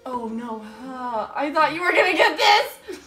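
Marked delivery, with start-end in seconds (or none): none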